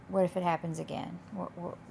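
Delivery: ordinary delivery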